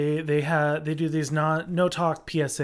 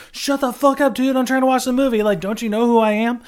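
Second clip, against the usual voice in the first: louder, higher mock outrage; indignant, raised-voice retort; annoyed telling-off; 6 semitones higher, 6 dB louder than usual. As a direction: tell off a chatty friend mid-movie. Retold telling-off of chatty friends.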